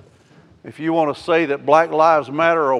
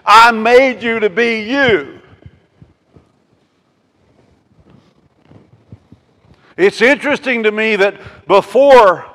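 raised, forceful sermon voice; fiery preaching; 6 semitones higher, 11 dB louder than usual. Real, not acted. Preacher telling the church of Moses and Jesus, fiery preaching. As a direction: preach it out loud and high.

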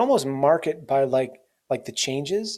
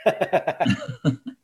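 light laugh; 4 semitones higher than usual. Real, not acted. Laughing at joke.

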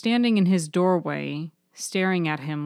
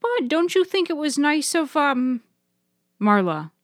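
higher coaxing Nana; much higher, pointed Nana prompt; prompting grandmother; 9 semitones higher than usual. Acted. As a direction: nudge the boy along in a high voice.

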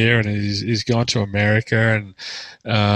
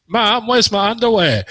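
put-on high, loud kid's call through laughter; laughing imitation of a child shouting for mom; 11 semitones higher, 6 dB louder than usual. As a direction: yell up to mum like a kid who can't find his underwear. Kid calling mom, retold story.

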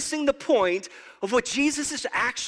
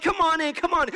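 higher, beckoning come on in; eager welcome; 7 semitones higher than usual. Retold or imitated. Preacher voicing Jesus ushering people into heaven, eager. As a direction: wave them through warmly.

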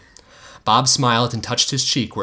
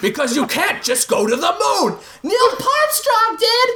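shouting match. Acted. Acted father-daughter shouting match.

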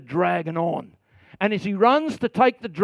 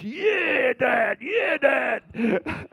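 put-on higher, cheering kid's voice; imitating excited daughter; 4 semitones higher, much slower than usual. Pastor imitating his daughter cheering at the movie. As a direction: cheer like a thrilled child. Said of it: "Haley goes".

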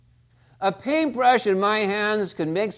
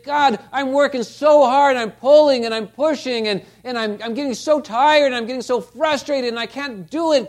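frustrated prayer; 6 semitones higher, 7 dB louder than usual. Retold or imitated. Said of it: "And sometimes we think".

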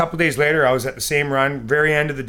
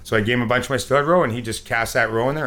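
usual speaking voice

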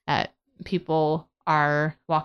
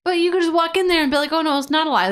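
high, louder heated argument; exasperated; 10 semitones higher, 4 dB louder, faster than usual. Real, not acted. [name] arguing with Dalton about the lie riddle, heated and high. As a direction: push back hard, pitch rising.

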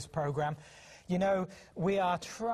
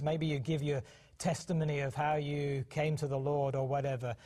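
ordinary talk

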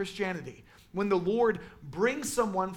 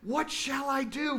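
freaking out; 6 semitones higher than usual. Retold or imitated. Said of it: "He's just freaking out".

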